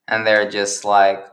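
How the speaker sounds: usual speaking voice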